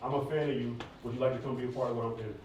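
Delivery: ordinary talk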